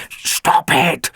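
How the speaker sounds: hissed sharp order; a clipped mock command. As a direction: cut the howling off sharply. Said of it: "ordered Fix-It".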